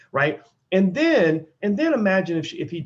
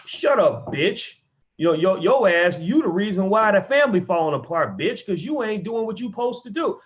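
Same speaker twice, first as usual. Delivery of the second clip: mock angry abuser; 6 semitones higher than usual. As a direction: play the abusive party snapping back in mock fury. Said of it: "they're like".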